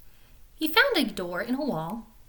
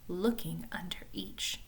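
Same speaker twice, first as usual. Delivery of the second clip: whisper; 4 semitones lower, 10 dB quieter than usual. A narrator's reading. Tense hiding scene.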